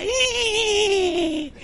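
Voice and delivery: high, soft, drawn-out squeal; imitated baby squeal. Pastor imitating the baby girl lifting her head, high squeal. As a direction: mimic a baby's long high cry.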